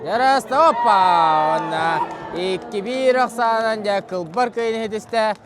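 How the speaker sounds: loud, high shout easing slightly; excited winning call. Real, not acted. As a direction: burst out shouting, then announce the win. Live call of the winning point.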